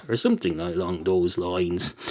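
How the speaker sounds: ordinary talk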